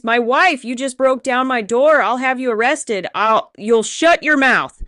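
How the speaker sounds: loud indignant threats; blustering lawyer. Acted. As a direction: bluster and threaten to arrest him. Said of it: "his lawyer voice".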